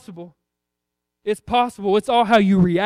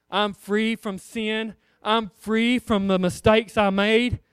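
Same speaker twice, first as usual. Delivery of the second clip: slow declaration; slower than usual. Acted.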